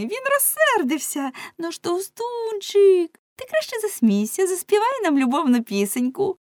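high, lilting, loud coaxing tease; mocking women. Women coaxing fat man to sing, high mocking lilt. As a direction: tease in a high girlish singsong.

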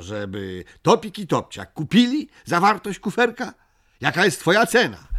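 high, loud, eager character voice; Migotek calling out his offer. A narrator's reading. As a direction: pitch it up and project to the other side. Migotek bargains with Buka aloud. (wysoki, donośny głos Migotka)

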